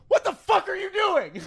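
high, angry mock yell; high furious rebuke; retold yell. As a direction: tell her off sharply. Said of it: "and I go".

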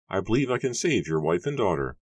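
Matthew's character voice. A narrator's reading. Matthew continues in his high voice.

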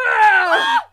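normal talking voice

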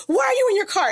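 high, rapid-fire worried questions; anxious friend grilling a sick friend over FaceTime, high and panicky. Retold or imitated. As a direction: pepper him with frantic health questions. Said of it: "I was freaking out".

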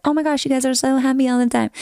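high, quick take-off of admirers; mock gushing. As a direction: gush like an onlooker, fast and high. Voicing people who envy their lives.

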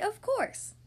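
high exclamation of realization; excited cry. Acted. Mister Happy realizing who can help, crying out. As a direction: exclaim it as if suddenly obvious. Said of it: "cried Mister Happy".

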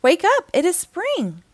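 high, loud wake-up call; Frog's excited shout. A narrator's reading. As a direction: call it out high to rouse a sleeper. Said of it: "shouted Frog".

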